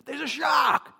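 high, urgent mock yell; retold warning shout. Husband on the beach warning his surfing wife, shouted alarm. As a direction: yell the warning across the water.

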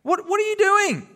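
high, incredulous what; mock disbelief at the people; hammed-up shock. Teacher mocking shock at Israelites.

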